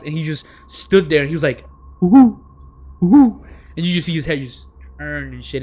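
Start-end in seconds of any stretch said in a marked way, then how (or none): 2.0-3.4 loud, high hooting like an owl; owl hoot imitation.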